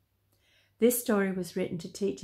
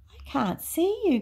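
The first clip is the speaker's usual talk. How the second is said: pitched-up pained complaint; hurt, high character voice; groaning; 10 semitones higher, 3 dB louder than usual. A narrator's reading. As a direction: groan it like a sore monkey. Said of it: "groaned Toto".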